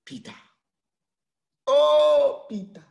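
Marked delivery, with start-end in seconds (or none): 1.7-2.4 a loud, high cry of "oh"; high, loud grieving cry of oh; anguished loud oh. Real, not acted. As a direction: wail out the oh in grief. Preacher cries out over Peter.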